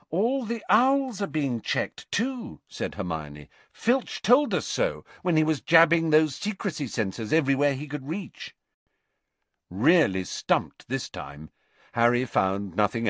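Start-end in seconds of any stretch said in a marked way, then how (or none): 0.1-2.6 high, slow, emphatic objection; pitched well up, drawn-out correction; Hermione. A narrator's reading. Narrator voices Hermione in dialogue. 3.7-8.6 high, brisk explaining voice; pitched-up, emphatic girl making her case; Hermione. A narrator's reading. Narrator voices Hermione in dialogue.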